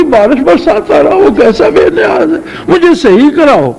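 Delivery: high-pitched, pained remorse, acted out; retold grief. Old shaykh begs his pupil to correct him, retold grief. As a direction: plead in a high voice, as if stricken with regret. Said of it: "اسے اتنا دکھ ہوا".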